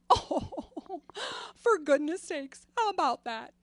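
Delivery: put-on loud, high, laughing old mother's voice; mother's joyful reply. Mother laughing delightedly at her daughter's story, loud and high. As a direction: laugh through it like a delighted grandma. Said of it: "And she would say".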